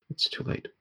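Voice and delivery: soft, near-whispered foreboding; hushed warning. Acted. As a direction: breathe it out as a grim warning. Frightened woman's hopeless warning.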